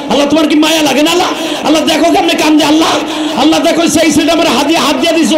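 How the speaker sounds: high, urgent preaching that builds to shouts; impassioned sermon at full force; fiery preaching. Real, not acted. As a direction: drive the crowd to run and weep.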